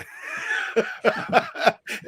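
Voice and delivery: high unvoiced giggle; breathy laugh. Real, not acted. Laughing at his own admission to viewers, high and airy. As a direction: laugh at your own joke.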